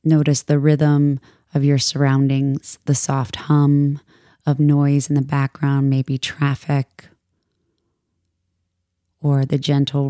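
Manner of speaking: calm, measured meditation voice with long pauses; soothing yoga teacher guiding a meditation; guided meditation. Acted. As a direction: ease listeners into stillness, unhurried and even.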